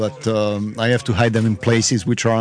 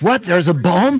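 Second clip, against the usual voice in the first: higher-pitched incredulous question; mock alarm; 7 semitones higher, 3 dB louder, slower than usual. Bystander misreading a complaint as a bomb threat, mock alarm. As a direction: play startled disbelief, pitch jumping on "bomb". Acted panicked reaction to bomb.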